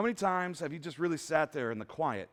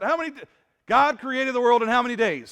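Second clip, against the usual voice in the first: asked again, louder and more pushing; louder repeat to the crowd; 5 semitones higher, 10 dB louder than usual. Real, not acted. Preacher repeating his question louder to a sleepy crowd, loud and pushing. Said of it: "Only two people answered that".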